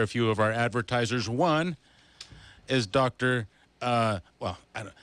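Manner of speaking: ordinary talk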